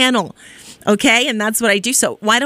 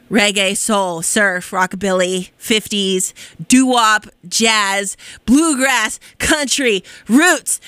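ordinary talk, nothing marked

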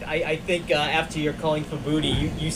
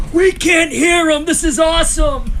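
loud, high cheering crowd voice; excited shout; 13 semitones higher, 6 dB louder, slower than usual. Retold or imitated. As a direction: yell it like a thrilled fan. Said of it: "listening audience is like".

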